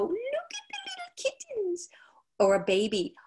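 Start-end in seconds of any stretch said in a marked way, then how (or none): 0.0-1.9 high breathy whisper to kittens; kitten talk. Retold or imitated. Cooing at little kittens in a high whisper. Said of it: "and we go".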